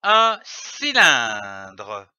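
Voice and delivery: "un cylindre" slow and joined, stretched at the end; "un cylindre" drawn out without breaks; whole word joined. Shown as a demonstration. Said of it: "donne le mot".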